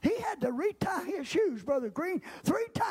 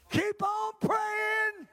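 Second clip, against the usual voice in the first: fiery preaching; 7 semitones higher, much slower than usual. Acted. Preaching at full force.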